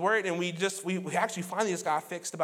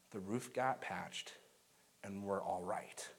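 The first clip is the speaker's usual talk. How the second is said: slow, much softer and lower; soft reassurance; 11 semitones lower, 13 dB quieter, slower than usual. Real, not acted. Pastor assuring the congregation the family is all right, hushed and slow. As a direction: let it settle gently. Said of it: "soft reassuring resolution".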